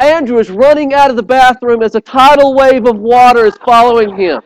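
normal talking voice

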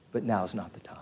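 unvoiced whisper, low and resigned; resigned whisper of acceptance. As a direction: whisper it as if to yourself. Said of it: "John sitting there and going".

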